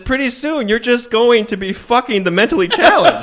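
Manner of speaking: high, loud, laughing sermon; laughing mock lecture. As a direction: preach it while cracking up.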